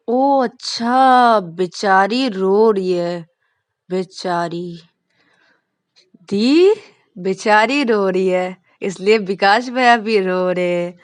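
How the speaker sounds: loud, drawn-out sarcastic pity; mock sympathy. Younger brother to his sister, sarcastic mock pity. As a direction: stretch the words to mock him. Rahul mocks Vikas.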